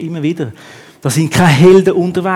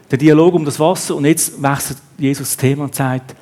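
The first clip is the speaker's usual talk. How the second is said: ordinary talk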